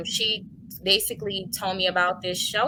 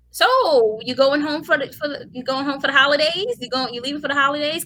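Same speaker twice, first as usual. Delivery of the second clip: high, louder small-talk voice; chatty driver; 7 semitones higher, 4 dB louder than usual. Retold or imitated. Cab driver asks passenger about holidays, chatty and high. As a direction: imitate an upbeat driver making conversation.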